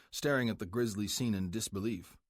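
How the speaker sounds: ordinary delivery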